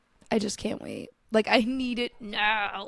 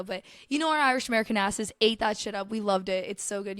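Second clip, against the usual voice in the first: ordinary talk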